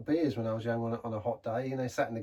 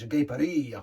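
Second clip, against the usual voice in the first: mock French; 2 semitones higher, 4 dB louder, slower than usual. Mock French flourish on Paris.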